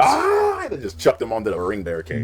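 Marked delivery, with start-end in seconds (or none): 0.0-0.6 high, loud mock war cry; mock yell. Imitating Batista yelling before the clothesline. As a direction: scream it as you swing. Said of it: "he was like".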